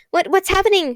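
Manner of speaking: high, panicked cry of confusion; frightened cry. Acted. Kate cries out in fear as her mind goes blank, high and panicked. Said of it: "she cried".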